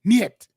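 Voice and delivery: "Niet" said high and loud, one firm syllable; Russian niet. Shown as a demonstration. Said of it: "That's how you say it".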